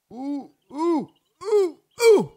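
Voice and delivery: high, rising yelps of alarm; quick string of high-pitched oohs; startled oohs. Real, not acted. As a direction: yelp as if about to fall. Almost falls in the game.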